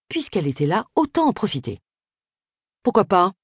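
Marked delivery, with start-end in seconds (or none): 2.8-3.3 Agatha's reply, higher and louder, falling on pourquoi; a brisk decisive woman shrugging yes. A narrator's reading. Agatha accepts the haircut offer.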